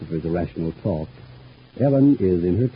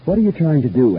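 louder, higher, demanding question; suspicious challenge; 7 semitones higher, 7 dB louder than usual. Acted. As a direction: confront him with sudden suspicion. Suspicious challenge to visitor's motives.

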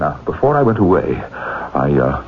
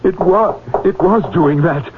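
WAS pitched high and insistent; insist, lifting WAS well above usual pitch; higher-pitched insistence on WAS; 7 semitones higher than usual. Acted. Insists against a doubter.

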